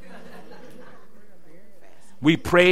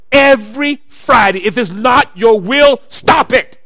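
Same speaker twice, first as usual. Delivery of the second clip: louder, rising insistence; rising preacher call; 3 semitones higher, 7 dB louder, slower than usual. Acted. Preacher pressing the congregation, louder and urgent. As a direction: build the prayer up, pressing harder.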